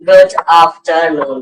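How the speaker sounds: गुड afternoon said slowly, word by word; measured teacher's demonstration; slow model phrase. Shown as a demonstration. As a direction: model the greeting slowly for learners. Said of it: "क्या बोलते हैं?".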